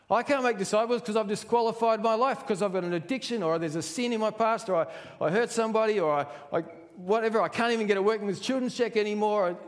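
high, loud, hurried protest; acted flustered self-disqualifying; excuse-making. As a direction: reel off one excuse after another. Acts out believers piling up excuses.

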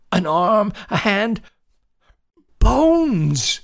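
high, loud, mounting panic; breathless panic. A narrator's reading. Boy telling his dad about buried bones, panicked and gasping. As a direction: blurt it in bursts, climbing to a cry. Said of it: "He gasped for air".

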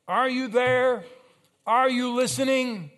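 high, loud pleading call; calling out to God. Acted. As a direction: cry out the questions to a silent God. Voicing a doubter calling to God.

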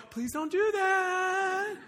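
high, slow, pleading mock voice; whiny mock plea. Parent wrongly pleading with running kid, hammed-up whine. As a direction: beg weakly and drag it out. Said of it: "You don't go".

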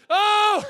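sudden high loud gasp of alarm; sharp shocked shout; startled yelp. Acted. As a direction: jump at a fright. Startled reaction to attack.

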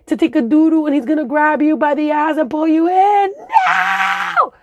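put-on high kid voice pleading, ending in a yell; urgent little girl begging friends to hurry; panicked kid. Kid warning classmates about the toilet, high urgent voice. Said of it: "kid warning friends".

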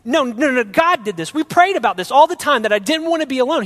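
indignant rising argument; angry. Retold or imitated. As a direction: fire back defensively. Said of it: "I got really mad".

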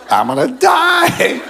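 high, drawn-out comic wail; mock panic. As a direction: wail it like a hammed-up doomed victim. Retold panic alone in forest.

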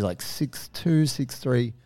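ordinary delivery

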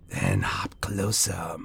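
low, slow whisper; icy luring voice. A narrator's reading. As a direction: whisper an eerie invitation. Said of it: "an icy voice".

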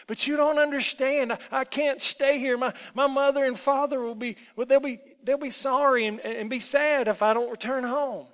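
loud, high, stumbling protest; desperate pleading. Acted. Lost boy begs the woman to let him home, desperate.

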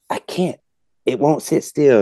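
high-pitched imitated kid complaint; little girl. Daughter arguing with her father while aiming, child's complaint. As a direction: mimic a child protesting. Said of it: "And she's like".

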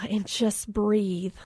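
slow, soft meditation-teacher voice; zen instructor. Retold or imitated. Instructor addressing her only student, slow soft zen voice. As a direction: float it out like a calm yoga guide. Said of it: "she's kinda got her zen on".

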